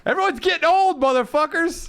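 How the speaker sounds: very high, loud mock yell; mock shout. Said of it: "shouting at the year".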